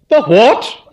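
high, loud bark of surprise; booming aunt blurting out; the aunt's high loud startled voice. A narrator's reading. Aunt Dahlia's loud interjection.

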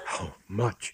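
understated horrified gasp; faint appalled gasp; gasped question. A narrator's reading. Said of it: "he gasped".

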